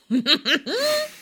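high, loud laughing fit; burst of laughter. Real, not acted. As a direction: crack up laughing. Hosts laughing at fanfic.

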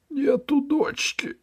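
high, slow pleading whine; whining ferryman. Acted. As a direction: plead poverty. Ferryman denies. (высоко, жалобно и протяжно)

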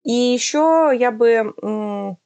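ordinary talk

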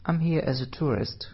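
ordinary talk, nothing marked

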